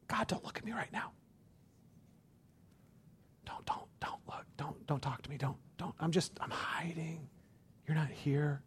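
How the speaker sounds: hushed, unvoiced plea to God; sheepish whispered prayer; hiding whisper. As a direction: whisper like you're hiding under the covers. Hiding from God mid-sin.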